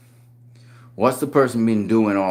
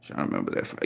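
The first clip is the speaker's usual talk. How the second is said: whispered aside; 8 dB quieter, faster than usual. Real, not acted. Muttering to himself.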